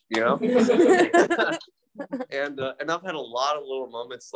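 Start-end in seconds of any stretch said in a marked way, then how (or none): 0.4-1.6 loud burst of laughter. Real, not acted. Laughs after God's punchline.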